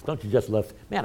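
ordinary talk, nothing marked

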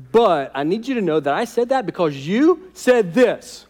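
rising to high, loud self-justifying; defensive husband. Retold or imitated. Husband apologizing to Cheryl, then blaming her. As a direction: build from sorry to accusing.

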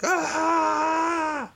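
high mock scream of delight; excited squeal. Imitating excited fans.

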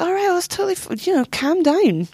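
hurried, high-voiced bid to calm someone down; placating. Placating him in retold quarrel.

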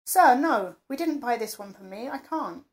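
high, loud panicked begging; terrified plea. Acted. Submissive pleading with her Sir, frightened and loud. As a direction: beg him to stop in sudden fright.

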